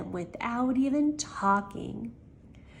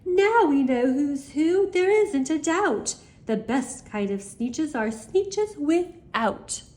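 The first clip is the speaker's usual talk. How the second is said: proud shout; 6 semitones higher than usual. A narrator's reading. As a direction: crow it to the whole beach. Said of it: "they let out a shout".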